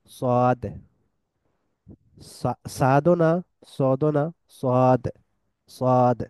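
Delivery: সোয়াদ said as S with a W glide, drawn out; Arabic sad sound. Shown as a demonstration. Teacher drilling the সোয়াদ letter for students, drawn out. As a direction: give সোয়াদ a rounded S plus W. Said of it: "S এর পর W দিলে যেরকম হয়".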